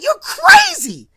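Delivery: shrieking at her brother to get out; furious high yell. Retold or imitated. Sister screaming at her brother while hitting him. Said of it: "she hits J R like".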